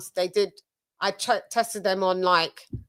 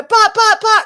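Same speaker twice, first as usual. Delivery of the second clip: flustered sputter; 13 semitones higher, 8 dB louder than usual. Retold or imitated. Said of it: "she was a".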